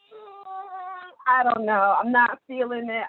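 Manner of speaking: imitating their own past reluctance; hesitant reluctance. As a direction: sound unsure and pull back from the offer. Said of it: "I was very hesitant".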